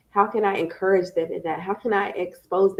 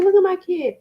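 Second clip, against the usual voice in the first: higher, proud gush; proud mom; 11 semitones higher, 4 dB louder than usual. Retold or imitated. Mom proudly watching her son make pizza, higher gush. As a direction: beam about your kid. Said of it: "And I'm like".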